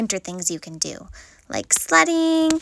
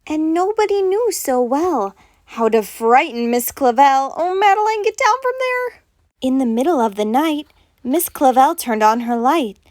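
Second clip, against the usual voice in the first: put-on story reading for kids; 4 dB louder than usual. Teacher reading Madeline to the class. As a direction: read it like bedtime verse to children. Reads the picture book aloud.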